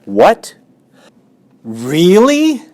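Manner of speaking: high, loud hopeful gasp; surprised cry. Acted. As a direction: cry out in sudden hope. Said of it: "cried Wilbur".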